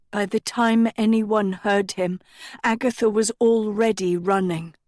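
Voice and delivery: plain reading voice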